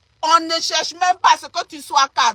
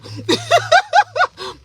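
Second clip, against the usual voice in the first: high shrieking laughter. Real, not acted. Mocking jealous commenters, high shriek of laughter.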